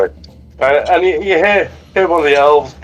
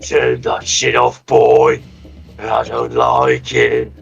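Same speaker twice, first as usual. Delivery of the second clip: slow, lower barbarian growl; gruff barbarian voice; 12 semitones lower, much slower than usual. Acted. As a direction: drop into the barbarian. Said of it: "wrong voice".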